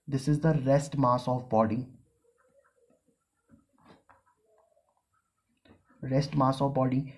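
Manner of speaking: very slow, one term at a time; teacher dictating the formula. Shown as a demonstration. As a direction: spell the equation out for note-taking. Dictating a definition.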